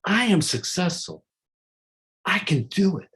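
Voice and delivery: energetic, convinced affirmation; with feeling. Shown as a demonstration. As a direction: proclaim it like you truly believe it. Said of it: "You gotta say it with feeling".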